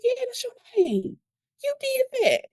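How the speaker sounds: very high, hushed squeal of pride; high, sing-out encouragement; thrilled teacher praise. Retold or imitated. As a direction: re-enact celebrating a pupil's success. Retelling her praise of amazed pupil.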